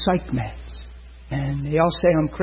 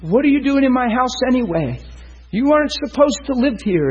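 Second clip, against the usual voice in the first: put-on high, sharp scolding of the unseen kids; mock angry telling-off in her chair; a retold old lady snapping at the kids; retold yell at the children; 9 semitones higher than usual. Lady yelling at ghost children.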